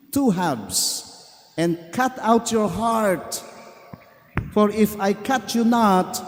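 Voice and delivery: mild hint of weeping in a grieving master; crying voice. A narrator's reading. Master grieving as he tells bamboo it must be split. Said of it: "with a crying voice".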